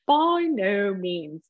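slow, drawn-out British reading of By no means; British accent. Shown as a demonstration. Pastor reads Romans to the congregation in a British accent. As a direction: intone it like a British Bible narrator. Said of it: "my favorite one to listen to in a British accent".

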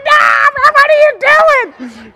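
high, loud shriek re-enacting his panic; screaming at the screen. Moviegoer yelling at the man in the film, screamed. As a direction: yell in alarm at the man on screen. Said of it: "I literally screamed at the screen".

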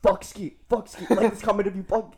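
high, loud hyped-up chant of a fan; mock hyped commenter. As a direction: rile up the comment section. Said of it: "And he'll be like".

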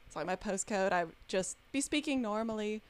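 normal talking voice

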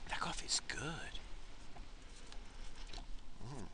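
a soft, slow, breathy whisper of pleasure; savoring whisper. Real, not acted. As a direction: murmur it like the first sip is perfect. Savoring coffee while driving.

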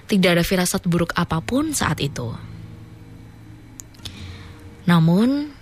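slow, spooky story reading; story narration. Acted. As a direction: read it like a ghost tale. Reads listener's horror story.

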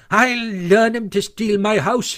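high, slow vengeful boast; crying out. A narrator's reading. Toad threatens imaginary animals with his stick, crying out. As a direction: call it out with drawn-out relish. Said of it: "he cried".

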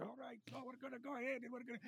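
high, very soft far-off calling; distant voice. Acted. Raid leader overheard across the game by local voice chat, distant calling. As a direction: call out as if heard from a long way off. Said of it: "them in the distance".

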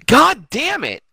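high, loud, drawn-out curse; exasperated outburst. Real, not acted. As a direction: let the curse rip, dragging it out. Mock outburst at co-host's teasing.

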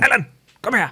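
high-pitched mock call to a dog. Dog owner calls Allan over, mock shout. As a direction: call out as if summoning your dog. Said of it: "du kan råbe af Allan".

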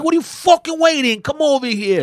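very high, loud mock yell; hammed-up tough guy. Retelling the tire shop, the local guy yelling him over. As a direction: shout it like a pushy local. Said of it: "And he's like".

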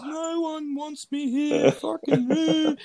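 high, slow mock sobbing whine; mock bawling. Teller mimics his drunk crying friend, mock sobbing. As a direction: imitate a drunk crying mate. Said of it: "I was like "What is going on?".

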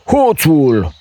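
high, loud cry of thief; shouted accusation. A narrator's reading. Ogre shouting thief after Jack. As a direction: yell it after the fleeing boy. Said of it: "auzi el dinspre castel".

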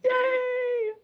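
very high squealed cheer; excited yay. Real, not acted. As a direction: let out a delighted squeal. Host cheers guest's arrival.

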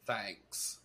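low, soft Southern drawl; Southern accent. Pretend. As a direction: say it with a Southern twang. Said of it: "kinda sound like Forrest Gump".

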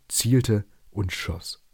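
plain reading voice

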